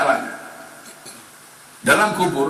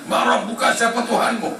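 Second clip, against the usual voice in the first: higher, commanding question; questioning angel; 9 semitones higher than usual. Acted. Angels questioning the dead in the grave, higher. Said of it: "mereka datang".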